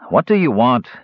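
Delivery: usual speaking voice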